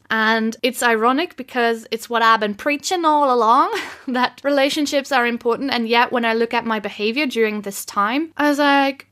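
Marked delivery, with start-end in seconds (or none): none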